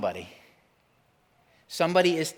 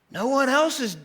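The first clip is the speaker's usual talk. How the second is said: high, loud mocking voice; mock protest; 12 semitones higher, 5 dB louder than usual. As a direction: squeak out a mock objection.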